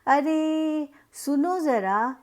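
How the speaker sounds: high, loud and slow, vowels stretched; slow hail to someone far away; calling out to someone. Shown as a demonstration. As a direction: call across the courtyard, dragging the vowels. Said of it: "तब भी हम इसी तरह से कहते हैं".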